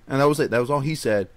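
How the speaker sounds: ordinary talk, nothing marked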